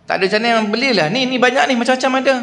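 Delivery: high, fast pushy stall aunty pressing a sale. Retold or imitated. Pushy aunty urging a purchase. (mak cik peniaga yang mendesak pelanggan membeli, suara tinggi dan laju)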